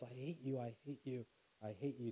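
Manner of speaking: soft, distant echo dying away; imitated echo. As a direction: repeat it softly like an echo off mountains. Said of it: "the mountains yells".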